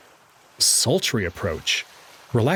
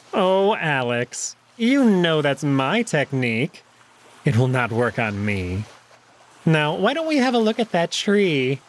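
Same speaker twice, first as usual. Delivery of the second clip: amused jackal; 4 semitones higher than usual. Acted. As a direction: savour the upper hand. Neferu voiced, slow amused.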